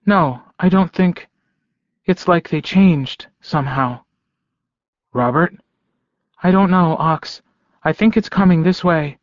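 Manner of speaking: hesitant, uneasy woman; worried woman. Acted. Elizabeth unsure on phone.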